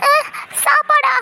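high, slow, startled question; eager surprise. Acted. Captive hears kidnappers offer food, eager surprise. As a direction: perk up at the mention of food.

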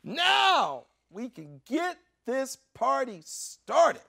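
high, loud, stretched-out proclamation; slow party-host holler; shout for the whole room. Retold or imitated. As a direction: announce it to the entire party. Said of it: "wants the whole party to hear this".

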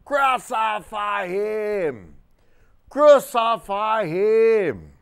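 high, very slow, drawn-out mob cry; mob shouting. Acted. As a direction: howl it like a hostile crowd. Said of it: "Everybody yelling".

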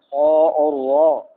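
औ और वो drawn out slowly, lips not rounded; slow Arabic pronunciation. Shown as a demonstration. Teacher slowly demonstrating unrounded letter names. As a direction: stretch out औ और वो without rounding the lips. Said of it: "इनमें होंठ गोल नहीं होंगे".

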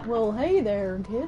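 hammed-up creepy clown hello. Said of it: "I sound like a clown".

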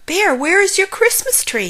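high-pitched, curious question; eager asking. A narrator's reading. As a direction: ask it high and eagerly, as a storybook character. Frog eagerly asks Bear in story.

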